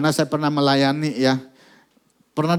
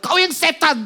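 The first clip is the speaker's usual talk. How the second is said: much higher, raging shot back; raging retort; 14 semitones higher, 4 dB louder than usual. Retold or imitated. Girl in a rage accusing the man holding her, furious shout. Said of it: "Anak ini ngamuk".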